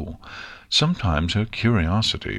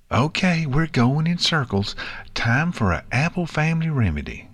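higher country filly voice; brisk-minded country kid, pitched up; Apple Bloom, decisive; 7 semitones higher than usual. Acted. Filly ending friend's fretting, decisive.

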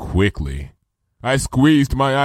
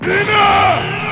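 high, loud, echoing roar; thunderous shout; 6 dB louder than usual. A narrator's reading. Princess silences her friend mid-protest, heaven-shaking shout. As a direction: cut them off with a booming command. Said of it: "Luna's voice literally shook the heavens".

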